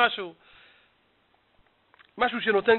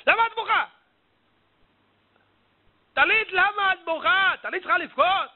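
high-pitched, astonished questioning; hammed-up bewildered protest; incredulous questioning; 8 semitones higher, 3 dB louder than usual. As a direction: ask in high, exaggerated disbelief. Acted questioning of weeping tallit.

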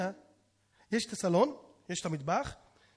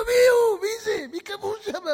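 high, shrieking wife; hammed-up alarmed outcry; frantic questioning; 12 semitones higher, 9 dB louder than usual. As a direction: wail the questions in mock hysteria. Wife frantic, asks who it is.

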